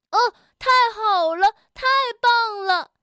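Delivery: high, loud delighted cheer. Acted. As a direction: cheer out with joy. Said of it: "跳跳欢呼着". (高声欢呼)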